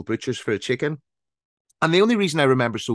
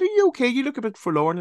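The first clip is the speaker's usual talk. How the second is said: kind stranger; 13 semitones higher than usual. Retold or imitated. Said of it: "came up to me and said".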